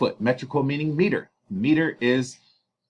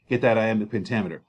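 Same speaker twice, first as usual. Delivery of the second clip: usual speaking voice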